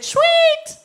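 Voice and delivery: high, loud, drawn-out squeal of delight; gleeful kid. Acted. Boy delighted by dad's secret plan, gleeful squeal. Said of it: "I said".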